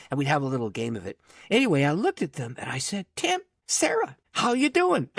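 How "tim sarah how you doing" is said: very high, drawn-out hello; caught-out hello in a raised pitch; sheepish surprised greeting. Retold or imitated. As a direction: act out an awkward, squeaky greeting. Acts out his surprised greeting at door.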